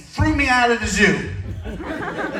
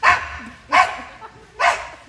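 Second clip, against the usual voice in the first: short high mock screams; three quick fake yelps of fear; 14 semitones higher, much slower than usual. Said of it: "I started screaming".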